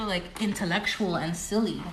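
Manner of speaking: ordinary talk, nothing marked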